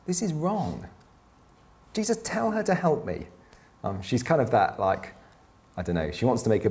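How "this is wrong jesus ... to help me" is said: higher, exasperated complaining; a mock exasperated Martha. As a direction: huff it out like a frazzled host. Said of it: "Martha goes".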